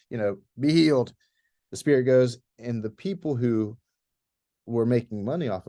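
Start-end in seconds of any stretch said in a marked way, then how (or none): 0.6-1.1 louder, mildly yelled command; retold yell. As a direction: imitate an annoyed man's brief yell. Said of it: "he turns around and he yells".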